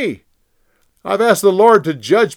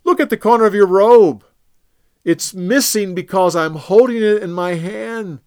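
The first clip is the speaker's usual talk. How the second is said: high, loud, earnest appeal; David pleading with King Saul from afar; impassioned plea; 9 semitones higher, 9 dB louder than usual. Acted. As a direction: plead your innocence with all your heart.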